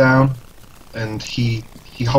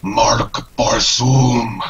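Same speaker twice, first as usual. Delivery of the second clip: incantation; much slower than usual. Acted. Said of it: "he just goes".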